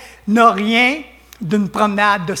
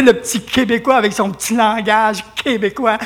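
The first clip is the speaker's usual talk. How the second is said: normal talking voice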